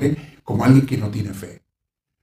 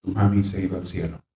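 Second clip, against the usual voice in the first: put-on softer, gentle comfort to a child; tender comfort; 5 semitones lower, 5 dB quieter than usual. Relative gently telling a grieving child mami went to heaven, soft and tender. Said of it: "se le dice".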